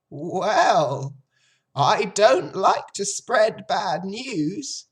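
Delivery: put-on high, loud, slow old sheep; drawn-out, knowing old sheep voice. A narrator's reading.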